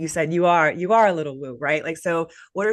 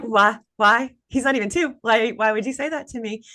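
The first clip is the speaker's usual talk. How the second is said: higher and louder, replaying her disbelief; why would you say that, pitched up in shock; incredulous protest; 6 semitones higher, 4 dB louder than usual. Retelling her reply to mom, higher incredulous protest.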